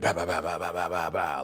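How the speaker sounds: rapid string of gunshot noises, low in pitch; low rat-a-tat volley; imitated gunfire. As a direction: mimic many shots in a row. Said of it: "it was multiple".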